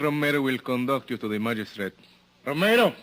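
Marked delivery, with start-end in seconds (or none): 2.4-2.9 sharp, loud, high summons; calling out. Acted. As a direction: call him in from the next room. Lieutenant summons the sergeant.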